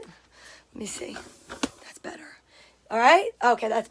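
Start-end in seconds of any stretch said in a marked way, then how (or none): 0.0-2.4 muttered aside. Real, not acted. Muttering to herself while setting up.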